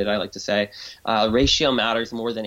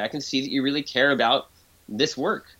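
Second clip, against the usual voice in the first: ordinary talk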